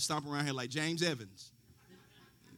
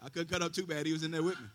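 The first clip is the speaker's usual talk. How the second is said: ordinary talk, nothing marked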